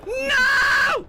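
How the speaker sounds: sudden high panicked yell; panicked shout. Real, not acted. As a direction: scream it in alarm. Player panics as character dies.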